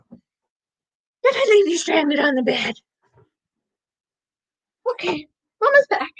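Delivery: high, cooing talk to a dog; pet talk. Real, not acted. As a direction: coo it high to a beloved pet. Talking to her stranded dog.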